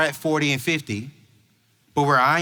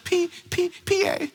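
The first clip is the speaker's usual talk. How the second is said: small, very high hesitant voice; mock tearful-teen timidity; teen girl; 13 semitones higher, 4 dB quieter than usual. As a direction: imitate a timid, stammering girl. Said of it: "And she's like".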